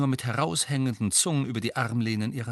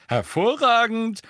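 high, loud, drawn-out delight; 12 semitones higher, 6 dB louder than usual. A narrator's reading. Fred pleased with his test result, delighted. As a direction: relish the word, slowly and loudly. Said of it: "sagte Fred vergnügt".

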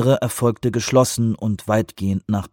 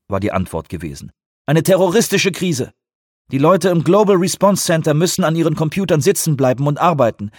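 insistent higher, louder official; emphatic official standing his ground; defiant counterterrorism director; 7 semitones higher, 4 dB louder than usual. Acted. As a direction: argue forcefully that the team must stay.

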